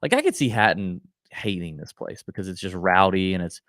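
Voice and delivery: ordinary talk, nothing marked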